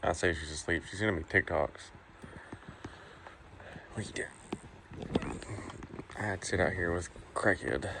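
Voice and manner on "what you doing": whisper. Real, not acted. Whispering to baby at night.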